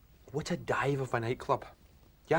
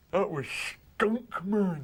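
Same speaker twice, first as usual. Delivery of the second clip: slow stoned drawl; 3 semitones higher, much slower than usual. Retold or imitated. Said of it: "And then he says".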